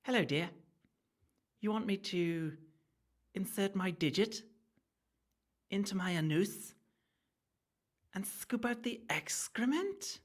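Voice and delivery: over-the-top high aristocratic Victorian woman scolding her servant; posh Victorian lady. Acted. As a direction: ham up a snooty Victorian lady refusing to wipe herself. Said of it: "that's not how she would talk. It's Victorian times".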